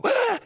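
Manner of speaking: sudden high yelp of fright; imitating his wife's sudden scream in the car; wife's startled shriek. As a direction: shriek like the car's about to crash. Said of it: "she'll go".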